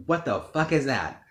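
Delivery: high, loud, disbelieving yell; shocked outburst. Retold or imitated. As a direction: blurt it out in shock. Shocked at mom's answer.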